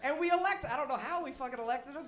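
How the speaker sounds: ordinary talk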